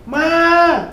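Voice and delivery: put-on high, loud child's call through the house; calling out for mama. Boy home from school calling his mother, high and loud. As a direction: shout for your mother, voice up high. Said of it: "dia teriak".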